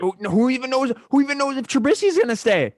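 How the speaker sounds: high, loud and worked up; fired up and loud, pitching it high; excited. Real, not acted. Heated what-if about quarterbacks.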